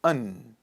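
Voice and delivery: AND reduced to "un". Shown as a demonstration. Teacher shows learners how to pronounce and, reduced to "un". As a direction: say "un", short vowel and no final d. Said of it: "Pronounce it as un".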